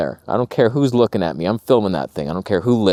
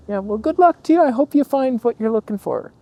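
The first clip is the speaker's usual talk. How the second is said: dismissive local; 9 semitones higher than usual. Retold or imitated. Woman quoted, dismissive farewell.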